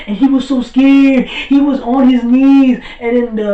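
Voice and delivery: high, loud and amazed; worked-up retelling. Real, not acted. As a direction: relive it at full fan excitement. Excited retelling of Frieza's terror.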